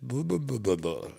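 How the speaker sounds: put-on high toddler babble; baby babble. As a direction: babble like an insistent little girl. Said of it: "And she's like".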